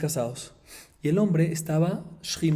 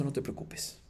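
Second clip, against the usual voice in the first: whispered comfort; 3 semitones lower, 12 dB quieter than usual. Retold or imitated. Husband consoles wife.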